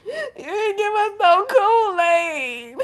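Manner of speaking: high, loud, drawn-out wail; mock crying. Cousins answer her pleading about the ice, hammed-up wailing. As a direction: bawl it in exaggerated mock tears. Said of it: "Crying tears".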